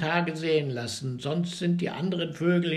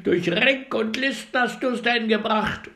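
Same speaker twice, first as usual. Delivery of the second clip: higher, louder reproach; angry birds; 8 semitones higher, 6 dB louder than usual. A narrator's reading. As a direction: scold the cheat loudly. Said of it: "schrien die Vögel zornig".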